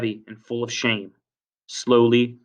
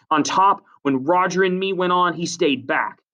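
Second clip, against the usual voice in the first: accusing; 7 semitones higher than usual. Acted. Jack publicly accuses Ralph.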